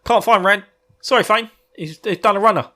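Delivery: high, loud panicky minion; lightly voiced cringing Trolloc; mock Trolloc. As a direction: blurt it out like a scared underling. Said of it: "who first came to him and said".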